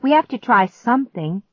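high, loud insistence; snapping. A narrator's reading. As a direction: insist with exasperation. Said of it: "I snapped".

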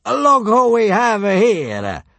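booming slow high soldier voice; bellowing centurion. A narrator's reading. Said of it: "bellowed the centurion".

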